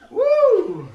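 high, loud woo; sudden high-pitched holler; loud whoop. Real, not acted. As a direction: let out a big whoop. Exclaims after scary story.

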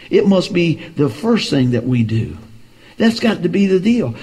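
ordinary delivery

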